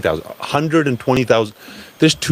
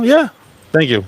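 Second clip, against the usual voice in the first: ordinary delivery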